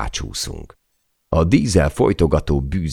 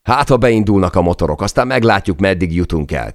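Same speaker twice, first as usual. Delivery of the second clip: high, loud call over the wind; a defiant shout against the gale; 5 semitones higher, 4 dB louder than usual. Acted. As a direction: shout to rally the crew. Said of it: "kiáltottam".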